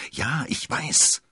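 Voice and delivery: usual speaking voice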